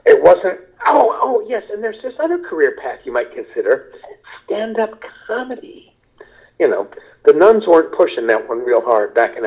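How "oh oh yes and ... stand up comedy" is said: much higher, then a soft prissy falsetto offer; mock career counselor. Mock career day nun offering stand-up, high and prissy. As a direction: lampoon a nun pitching an absurd career, sweetly.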